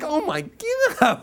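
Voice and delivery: slow, high, drawn-out oh my God; exasperated groan. Retold or imitated. Driver rear-ended in his new Ferrari, exasperated. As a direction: let the disbelief stretch out. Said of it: "Are you exasperated?".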